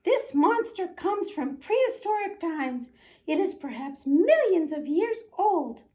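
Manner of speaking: high squeaky professor; professor mouse. Acted. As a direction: address the room like a tiny scholar. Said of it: "said Professor O'Hare".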